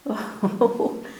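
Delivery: short breath of nervous laughter; uneasy laugh. Real, not acted. Investigator whose body is shaking, short uneasy laugh. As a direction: laugh briefly at the odd feeling.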